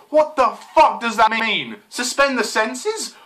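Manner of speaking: loud, high, incredulous outburst; exasperated outburst. Real, not acted. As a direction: raise your voice in slow disbelief. Baffled outburst at the lyrics.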